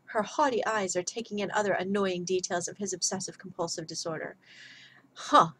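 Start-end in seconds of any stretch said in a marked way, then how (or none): 5.1-5.5 short, louder acted scoff; mock-scornful huh. A narrator's reading. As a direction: scoff it out in one beat. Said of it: "Sebastian scoffed".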